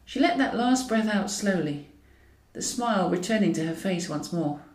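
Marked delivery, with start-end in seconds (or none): none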